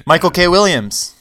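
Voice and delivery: high, loud burst of recognition; excited recall. Real, not acted. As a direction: blurt out the name as it comes back. Suddenly recalls the forgotten name.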